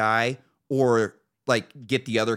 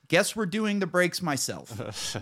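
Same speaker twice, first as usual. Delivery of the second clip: resigned; 8 semitones higher, slower than usual. Retold or imitated. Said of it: "I'm like".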